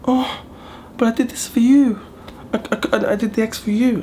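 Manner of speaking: very high wounded mock mother-in-law; mother-in-law hurt her eggs are refused, mocked very high. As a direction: squeak it up high, playing her hurt feelings. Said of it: "she's like".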